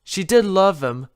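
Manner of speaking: usual speaking voice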